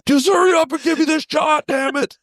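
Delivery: mock plea, high, laughing through it. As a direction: squeal it out between laughs.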